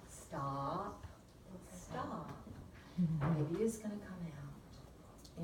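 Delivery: quietly steady her; a soft firm "Stop." then slow reassurance; soft firm calming. Retold or imitated. Midwife calming mother.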